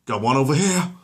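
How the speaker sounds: loud, high call. Acted. An exterminator calling to the squad, loud. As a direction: shout across the room.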